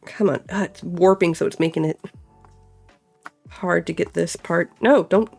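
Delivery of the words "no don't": ordinary talk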